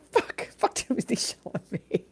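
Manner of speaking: a light, breathy mock Gene Wilder grumble; imitating Gene Wilder. Streamer imitating Gene Wilder cursing Chalamet, mock grumble. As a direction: toss off a half-whispered Gene Wilder curse. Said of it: "that was Gene Wilder, who was like".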